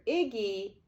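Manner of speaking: usual speaking voice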